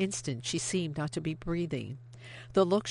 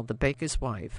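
usual speaking voice

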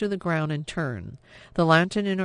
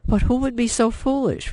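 alarmed; 8 semitones higher, 4 dB louder than usual. A narrator's reading. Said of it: "Vianne said".

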